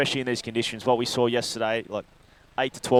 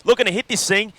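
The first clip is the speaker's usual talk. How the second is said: higher, louder surf commentary; excited live call; 6 semitones higher, 6 dB louder than usual. Real, not acted. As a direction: call the ride as it happens, really excited. Live call of a wave ride.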